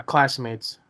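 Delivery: normal talking voice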